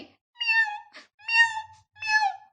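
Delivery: very high meows; meowing. A narrator's reading. The cat falls over, very high meows. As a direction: yowl like a tumbling cat.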